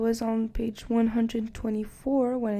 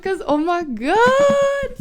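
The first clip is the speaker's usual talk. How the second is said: drawn-out loud oh my god; excited squeal; 10 semitones higher, 11 dB louder, slower than usual. Real, not acted. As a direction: squeal it, overwhelmed. Overwhelmed by the book.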